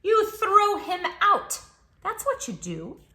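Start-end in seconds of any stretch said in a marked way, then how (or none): none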